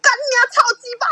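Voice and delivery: high, loud retold cursing shout from downstairs; angry yell. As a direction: yell it up at the window. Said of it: "就会大喊说".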